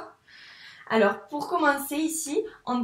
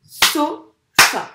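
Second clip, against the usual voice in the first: "So, fa" loud, split by a pause; syllables tapped out; 3 semitones higher, 11 dB louder than usual. Shown as a demonstration. Teacher tapping out two syllables, each loud and separate. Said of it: "Je te les tape".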